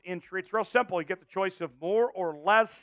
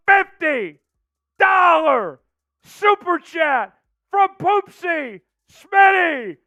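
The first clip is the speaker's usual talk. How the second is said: slow drawn-out hype announcement; hype shout; 10 semitones higher, 11 dB louder, much slower than usual. Real, not acted. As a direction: stretch every word like a showman. Super chat alert hype.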